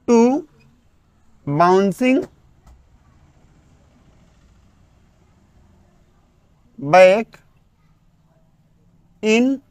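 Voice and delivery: very slow, separated words for note-taking; word-by-word dictation. Acted. Teacher dictating the English definition, very slow and spaced. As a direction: dictate each word with long gaps.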